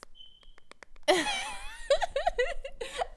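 high helpless laughing fit. Real, not acted. Laughing fit after failed ASMR.

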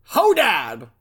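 loud shouted read-out. Retold or imitated. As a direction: shout the word out in caps. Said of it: "All caps, exclamation point at the end".

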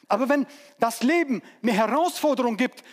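usual speaking voice

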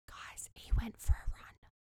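whispered disbelief; stunned whisper. Real, not acted. Telling viewers he left, stunned whisper.

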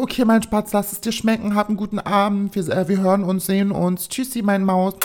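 high, fond motherly sign-off; liebevolle Mutter. Retold or imitated. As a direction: say a doting goodbye in a high mum voice. Mother's fond goodbye on phone. (liebevoll hohe Mutterstimme zum Abschied)

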